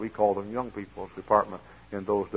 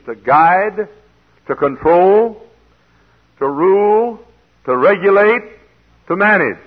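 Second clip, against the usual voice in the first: each word louder and higher, spaced apart; building preaching emphasis; 9 semitones higher, 11 dB louder, slower than usual. Real, not acted. Preacher hammering home what feed means, building up. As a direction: climb with each meaning like a revival preacher.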